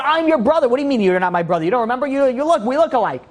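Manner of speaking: high, indignant mock yell; retold screaming. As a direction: act out a rejected brother's outburst, pitched well up. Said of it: "the way that you're screaming".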